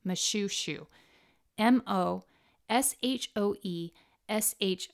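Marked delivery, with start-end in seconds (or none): 0.1-0.9 Moshoeshoe read as muh-SHOO-shoo; English spelling pronunciation. Shown as a demonstration. Showing how English speakers would misread it, Moshoeshoe said muh-shoo-shoo. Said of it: "any native English speaker would pronounce it".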